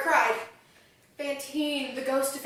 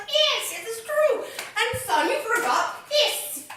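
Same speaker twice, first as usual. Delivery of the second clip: high mock priest; 9 semitones higher, slower than usual. Retelling kindly priest.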